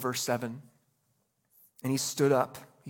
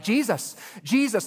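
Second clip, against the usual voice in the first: higher, louder calling of his name; pestering call; 7 semitones higher, 5 dB louder than usual. Retold or imitated. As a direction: keep calling Jesus to get his attention. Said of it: "they're like".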